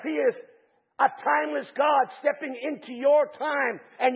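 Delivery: loud, high preaching, building; full-force Pentecostal preaching; fiery preaching. Acted. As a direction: preach it out to the congregation with fire.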